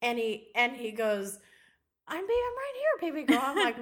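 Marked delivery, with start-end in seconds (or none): 2.1-3.4 high, quick cooing imitation; mock soothing husband. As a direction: coo reassurance like a doting husband. Husband soothing her after dream.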